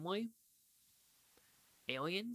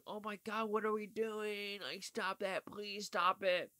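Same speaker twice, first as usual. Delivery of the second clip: higher, slow pleading oh my God; mock horrified plea; 7 semitones higher, 4 dB louder, slower than usual. Reviewer reacting to a cutting scene with slow mock horror. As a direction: beg them to stop, hammed-up horror. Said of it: "I was like".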